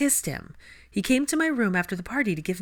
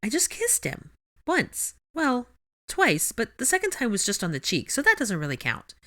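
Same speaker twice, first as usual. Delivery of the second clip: much higher, flustered protest; 6 semitones higher than usual. A narrator's reading. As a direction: deny it in a rush of embarrassment. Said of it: "protested Hermione".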